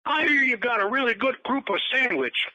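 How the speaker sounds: high-pitched put-on caller voice; Bill Cosby impression. Comedian calling a restaurant about a sandwich, high-pitched impression. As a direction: imitate a famous comedian phoning in an order. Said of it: "And we got a call".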